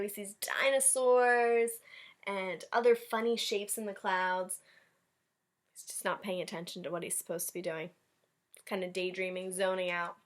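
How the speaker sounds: ordinary delivery, nothing marked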